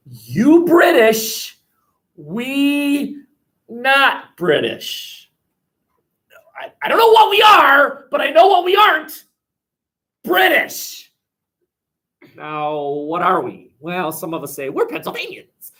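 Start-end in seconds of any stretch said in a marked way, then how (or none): 0.1-11.1 loud, high, slow defiant shout; defiant colonists. Acted. Teacher acts colonists rejecting British identity, defiant shout. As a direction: hurl "not British" like a rebel colonist.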